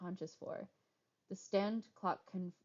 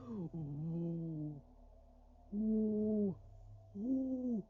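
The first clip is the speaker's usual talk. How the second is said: pained ooh; 4 semitones higher, much slower than usual. Real, not acted. Wincing at broken fingers.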